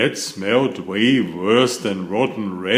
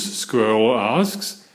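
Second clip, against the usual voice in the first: plain reading voice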